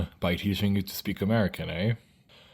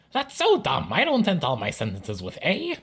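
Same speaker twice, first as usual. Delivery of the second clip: high-pitched, put-on protesting voice; much higher mock objection; indignant objector; 8 semitones higher than usual. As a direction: protest in a squeaky imagined-viewer voice. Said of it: "You may be thinking".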